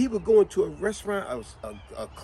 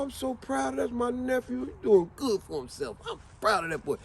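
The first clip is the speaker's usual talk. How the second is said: higher, excited and choked-up proud uncle; hammed-up proud old uncle, voice cracking; 6 semitones higher than usual. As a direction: gush like an uncle nearly crying with pride. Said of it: "He talk in a way, he say".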